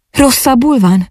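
high-pitched worried question; concerned. A narrator's reading. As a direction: ask with natural, anxious concern. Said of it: "kérdé az természetes aggodalommal". (aggódó, magas hangú kérdés)

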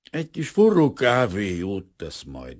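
usual speaking voice

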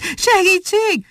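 high, slow, drawn-out cry; cry for help. A narrator's reading. Chased monkey cries for help, high wail. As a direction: wail for help while running. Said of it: "kiáltotta Muki".

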